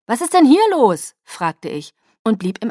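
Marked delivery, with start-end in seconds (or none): 0.1-1.2 high, loud, startled query; raised, puzzled voice; baffled question. Acted. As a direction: ask loudly what on earth is happening. Baffled daughter calls out.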